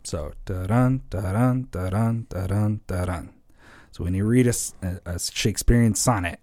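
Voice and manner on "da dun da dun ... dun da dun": "da-dun" with a light first beat and a heavy second; iambic rhythm. Shown as a demonstration. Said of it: "unstressed, stressed".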